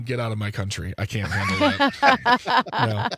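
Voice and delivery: mock outrage.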